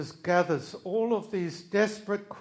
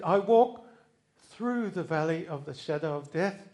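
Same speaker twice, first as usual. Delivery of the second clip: "walk" punched, rest steady; stress on walk; 4 dB louder than usual. Shown as a demonstration. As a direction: lean on "walk" like you mean it. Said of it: "I don't go around it or under it".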